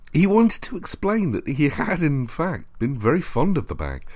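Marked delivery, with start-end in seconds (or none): none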